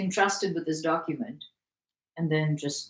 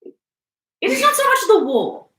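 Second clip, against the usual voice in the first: high, loud, slow pronouncement; hammed-up lofty oratory; mock-grand declaration; 12 dB louder than usual. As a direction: proclaim it slowly like a great aphorism. Mock-profound declaration, answering co-reader.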